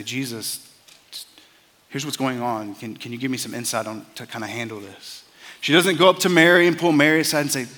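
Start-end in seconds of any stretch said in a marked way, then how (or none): 0.0-5.2 softly voiced, gentle request; hushed, polite asking for help; quiet and gentle. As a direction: ask calmly and privately, much softer. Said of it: "quietly and gently".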